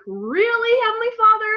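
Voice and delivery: high, squealing amazed gratitude; overjoyed disbelief. Retold or imitated. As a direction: gasp it out in thrilled disbelief to God. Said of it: "be like".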